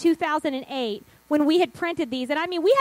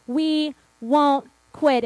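"We won't quit" slow, each word set apart; determined repeated vow; 2 semitones lower, much slower than usual. Retold or imitated. Church founders repeating their vow to God, slow and resolute. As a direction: hold back "quit" after a firm "won't".

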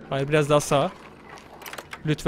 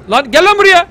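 higher, loud shout at an enemy; angry yell; 7 semitones higher, 10 dB louder than usual. Real, not acted. Player yelling at a chasing enemy, loud angry call. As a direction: bark it out to call the enemy over.

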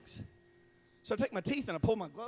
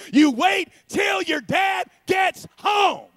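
high, slow, booming shout; hammed-up angry mother's warning; dreaded mom's threat; 13 semitones higher, 13 dB louder, much slower than usual. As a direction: thunder it out word by word. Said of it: "she said those horrible words".

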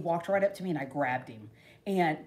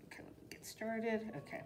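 whispered, softer aside; whisper to self; 14 dB quieter than usual. Real, not acted. Settling down to cut the shirt, whispering to herself. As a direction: breathe it to yourself as you start. Talking to herself mid-task.

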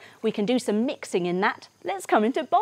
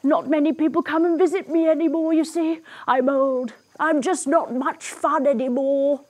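mock-weepy storybook voice; tearful crocodile; 5 semitones higher than usual. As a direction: play a sorry old crocodile crying. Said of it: "he begins to cry".

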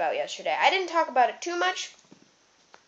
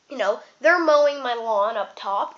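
normal talking voice

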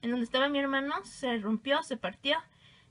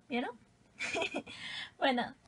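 usual speaking voice

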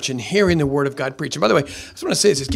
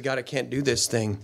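soft prayer; 4 semitones lower, 9 dB quieter than usual. Retold or imitated. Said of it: "I've just said".

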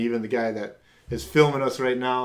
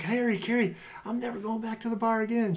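bursting with excitement, pitched up; excited high voice; 12 semitones higher than usual. Retold or imitated. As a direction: rush in overjoyed, voice high. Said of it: "I'm like".

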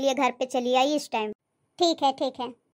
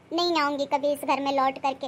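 usual speaking voice

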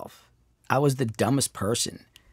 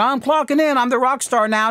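mock part-timer; 10 semitones higher than usual. Mocking part-time attitude.

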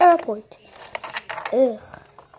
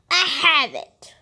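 ordinary talk, nothing marked

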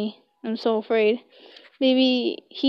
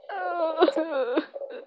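creepy laugh; 5 semitones higher, 7 dB quieter than usual. Acted. Villain laughs after threatening her.

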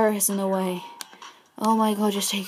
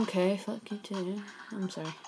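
ordinary delivery

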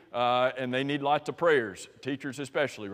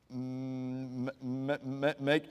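stumbling script reader; 3 semitones lower, 7 dB quieter, much slower than usual. Retold or imitated. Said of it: "was Jesus reading a script".

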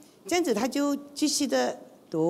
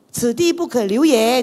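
declaimed saying; 2 semitones higher, 10 dB louder than usual. Retold or imitated. Quoting a classical saying.

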